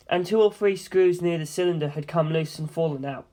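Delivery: plain reading voice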